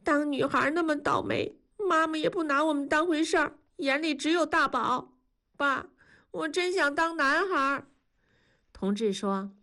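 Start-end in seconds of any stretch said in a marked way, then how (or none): 0.0-7.9 put-on higher, teary girl's grumble; a hint of crying as she tells her father she wants to be a boy; tearful complaint. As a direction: complain through tears. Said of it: "燕妮流泪了".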